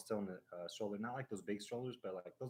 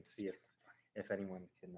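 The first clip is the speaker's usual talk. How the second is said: ordinary delivery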